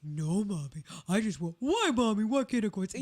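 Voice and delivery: whiny pet voice pleading with Mommy; pet's voice. Acted. Pet answering its owner back, put-on pet voice. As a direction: voice the dog as a complaining child. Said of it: "whatever your pet's voice is".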